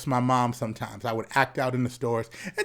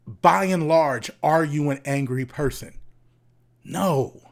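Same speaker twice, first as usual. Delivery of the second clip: ordinary talk, nothing marked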